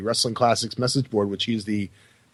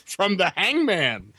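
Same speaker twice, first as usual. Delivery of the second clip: higher, slow, mock boastful child; proud kid; 5 semitones higher than usual. Kid showing the nurses a noose, mock proud. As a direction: show off a prize like a child.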